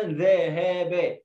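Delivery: ذهب split into drawn-out ذا، ها، با; wrong-way demo stretching each letter of ذهب; chopped wrong reading. Shown as a demonstration. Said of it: "بلاش تقول". (تقطيع ذهب حرفًا حرفًا بمد خاطئ)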